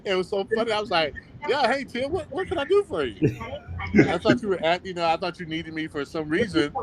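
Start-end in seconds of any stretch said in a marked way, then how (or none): 1.5-3.2 bright, high and quick, unaware of the news; imitating his own chipper hello; cheery phone greeting. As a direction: answer the call all sunny and eager.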